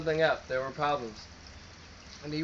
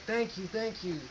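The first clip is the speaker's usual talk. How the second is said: high, soft mock gushing thanks; lightly voiced eager townsfolk, pitched up; grateful townsfolk; 7 semitones higher, 4 dB quieter than usual. Townsfolk thanking the hero, high and softly gushing.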